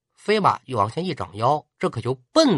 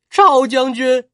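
crying out; 9 semitones higher, 5 dB louder, slower than usual. Acted. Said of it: "他放声大哭".

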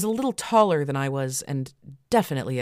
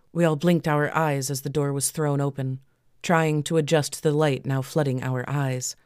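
ordinary delivery, nothing marked

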